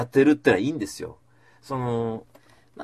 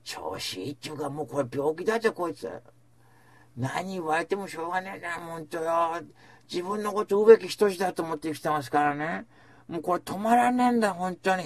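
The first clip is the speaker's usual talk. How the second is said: imitating the co-host calling him a flatterer, mock exasperated; 6 semitones higher than usual. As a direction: lightly voice the co-host teasing him. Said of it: "今もうーちゃんもね".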